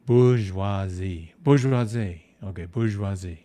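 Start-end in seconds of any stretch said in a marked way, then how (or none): 0.1-1.3 Bourgeoisie said slow, boor-zhwah-ZEE, no R; French pronunciation. Shown as a demonstration. Artist trying out a French word from chat. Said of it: "is gonna help me pronounce this". 1.4-2.2 Bourgeoisie as boor-zhwah-ZEE, no R; French pronunciation. Shown as a demonstration. Artist practising a word chat taught him. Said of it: "is gonna help me pronounce this".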